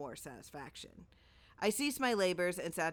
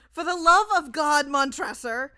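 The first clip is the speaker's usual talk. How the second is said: much higher, much louder anguished plea; desperate scream; 9 semitones higher, 13 dB louder than usual. A narrator's reading. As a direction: scream for mercy. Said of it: "to these words".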